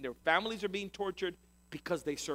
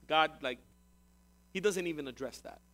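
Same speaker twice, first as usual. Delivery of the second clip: normal talking voice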